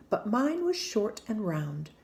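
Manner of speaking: plain reading voice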